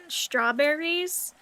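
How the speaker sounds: ordinary delivery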